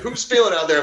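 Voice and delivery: loud hyped workout trainer. Retold or imitated. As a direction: call out to the class like a trainer. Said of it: "He's like".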